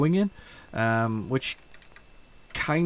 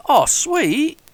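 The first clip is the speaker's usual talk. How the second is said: excited; 12 semitones higher, 5 dB louder than usual. Real, not acted. Delighted at game feature.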